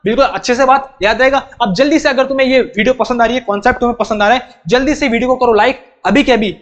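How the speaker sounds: ordinary talk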